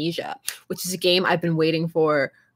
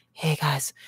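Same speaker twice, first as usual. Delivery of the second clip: mock ASMR host; 5 semitones lower, 3 dB quieter than usual. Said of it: "So I can be like".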